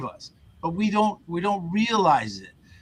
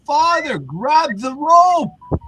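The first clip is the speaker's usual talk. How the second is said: calling helicopter crew; 12 semitones higher, 7 dB louder, much slower than usual. Retold or imitated. Helicopter crew calls priest.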